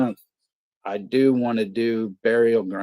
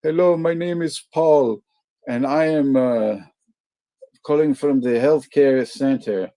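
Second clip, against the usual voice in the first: high, loud put-on telemarketer voice; mock polite phone salesman; spam caller voice; 5 semitones higher, 7 dB louder than usual. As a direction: read it like a scripted call-centre opener. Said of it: "finally he's like".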